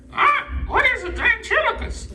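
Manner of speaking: very high squawky puppet voice; squawk, then ask in a high parrot voice; talking parrot. Acted. Parrot puppet questioning its ventriloquist, squawky high voice. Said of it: "a talking parrot".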